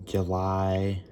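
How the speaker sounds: numb-mouthed slight lisp; numb face lisp. Real, not acted. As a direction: talk with half the face numb. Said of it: "it sounds like I have a lisp".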